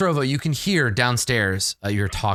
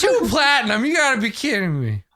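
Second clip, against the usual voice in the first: high-pitched incredulous protest; mock outrage; 9 semitones higher than usual. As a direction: balk at the price, voice jumping high. Character balks at a price.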